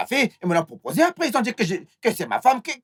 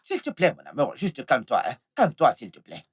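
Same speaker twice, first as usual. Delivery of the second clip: low, softened coaxing; placating murmur, pitched low; soothing plea; 9 semitones lower, 4 dB quieter than usual. Acted. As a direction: gently beg a sweetheart to settle down. Coaxing angry lover.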